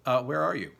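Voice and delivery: usual speaking voice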